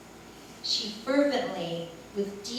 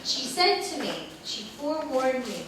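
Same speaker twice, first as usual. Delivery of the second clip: ordinary talk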